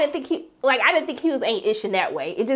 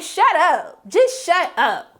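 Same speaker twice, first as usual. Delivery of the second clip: exasperated; 6 semitones higher, much slower than usual. Acted. Telling off the retold boyfriend.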